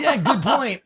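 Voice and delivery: high voice, breaking into laughter; laughing along. Acted. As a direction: agree while cracking up. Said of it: "And he's like".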